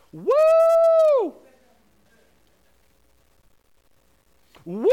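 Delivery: high, loud cheering whoop; crowd cheer. Retold or imitated. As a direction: whoop like a stadium crowd going wild. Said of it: "this is what tens of thousands of people will do".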